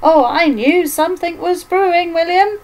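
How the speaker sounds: high, loud, excited exclamation; delighted old mother. Acted. Iris welcomes her long-lost son, high and delighted.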